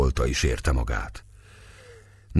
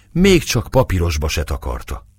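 ordinary delivery

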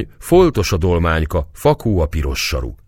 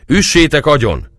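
high, loud soldier's shout; 9 semitones higher, 6 dB louder than usual. Acted. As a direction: cry it out as a harsh order to the crowd. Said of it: "kiáltott újból a sátorbontó katona".

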